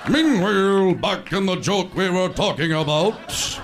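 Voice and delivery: loud, stretched opening word, announcer boom; cut-in announcer. Acted. Announcer cutting back to the joke, booming. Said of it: "that guy would come in and go".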